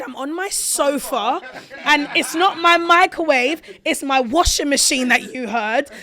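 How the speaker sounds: high, loud and slow, fed up; exasperated retort. Retold or imitated. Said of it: "I said".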